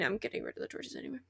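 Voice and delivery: usual speaking voice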